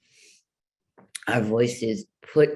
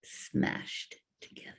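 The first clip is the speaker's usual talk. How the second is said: choked, unvoiced squeeze; strangled whisper; 11 dB quieter than usual. Shown as a demonstration. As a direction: press the words out through a clenched throat. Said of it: "Back of his tongue".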